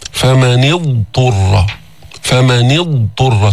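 فمن اضطر slowly, the ض fully sounded before the ط; careful tajweed recitation; clear ḍād before ṭā. Shown as a demonstration. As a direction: separate the ض from the ط in اضطر. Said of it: "تظهر الضاد بعدين تأتي بالطاء".

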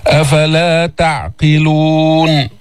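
slow chanted verse, heavy r; ترتيل بطيء; Quran recitation. Shown as a demonstration. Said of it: "فخم الراء".

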